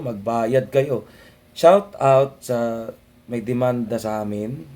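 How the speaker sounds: normal talking voice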